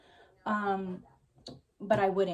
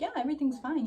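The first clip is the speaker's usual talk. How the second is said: higher-pitched forced-smile reassurance; falsely upbeat, pitched up; fake cheerful; 7 semitones higher, faster than usual. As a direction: put on a big smile over anger. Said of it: "with a big smile on my face".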